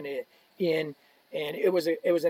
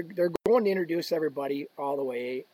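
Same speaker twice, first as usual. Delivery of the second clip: usual speaking voice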